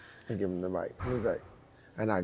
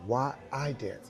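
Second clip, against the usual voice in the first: why I dance, with I pressed and slowed; stress on I; slower than usual. Shown as a demonstration. Teacher to young dancers, slowly leaning on I. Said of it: "Not why they dance".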